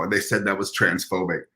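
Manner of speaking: ordinary talk, nothing marked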